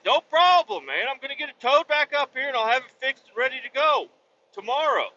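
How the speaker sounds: high-pitched imitation of easy assurances; breezy mechanic. Mechanic promises truck will be ready tomorrow, breezy. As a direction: mimic his glib promise, pitched well up.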